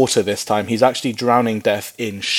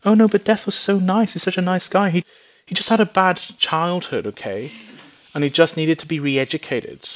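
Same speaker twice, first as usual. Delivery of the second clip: higher, pleading mock-sympathetic voice; mock sympathy; 7 semitones higher than usual. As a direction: parody a listener pitying death. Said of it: "I hope none of you are thinking".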